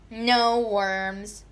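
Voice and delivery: usual speaking voice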